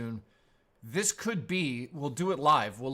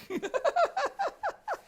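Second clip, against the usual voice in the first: a burst of high laughter, real; high cackling laugh. Real, not acted. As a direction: cackle at your own mistake. Still laughing at the slip.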